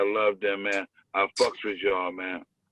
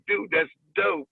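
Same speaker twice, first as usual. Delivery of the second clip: own retold reply; 9 semitones higher, slower than usual. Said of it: "I was like".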